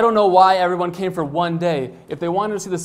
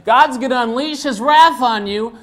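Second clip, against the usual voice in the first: higher, louder mock preacher of wrath; mock doomsaying zealot; 5 semitones higher, 5 dB louder than usual. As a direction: imitate a ranting bigot threatening divine punishment.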